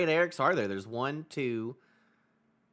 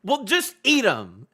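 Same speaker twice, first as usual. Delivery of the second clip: higher and louder, casually agreeing, then slowing at the end; breezy mock agreement; 6 semitones higher, 6 dB louder, slower than usual. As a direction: toss off a careless yes to Goldberg's plan. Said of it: "Scobber being like".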